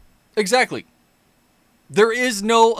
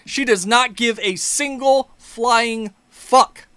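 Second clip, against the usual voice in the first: ordinary talk, nothing marked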